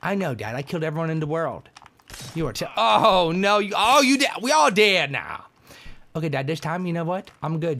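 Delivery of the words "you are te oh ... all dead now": hammed-up dad, high and loud; mock dad voice. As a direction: play the shocked father, loud. Dad voice reacting in game.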